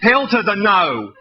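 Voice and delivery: slow sassy hell to the no; drawn-out refusal. Acted. Poet to crowd, slow sassy refusal.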